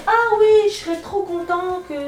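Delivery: high, sing-song mock French from France; mock French accent. Quoting boyfriend Félix in a joking French accent. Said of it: "que je l'imite, je mets un accent français".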